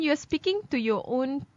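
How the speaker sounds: ordinary talk, nothing marked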